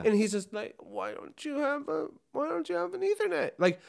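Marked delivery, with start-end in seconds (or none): 0.8-3.5 put-on baby noise. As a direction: squeak it out like a big man making baby sounds. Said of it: "make a baby noise".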